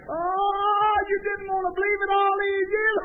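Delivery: loud, high preacher's cry; preaching shout. Acted. Preacher to the congregation, loud high shout. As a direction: shout it out to the pews.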